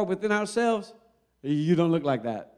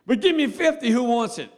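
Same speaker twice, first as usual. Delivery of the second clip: sharp high shout for fifty; yelling across the room; 7 semitones higher, 4 dB louder than usual. Acted. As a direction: bark it like a drill sergeant. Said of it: "calls out to the class".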